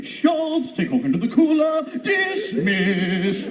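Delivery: high, barking mock German officer; Hogan's Heroes German. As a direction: play the camp commandant from Hogan's Heroes. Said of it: "with my brother going".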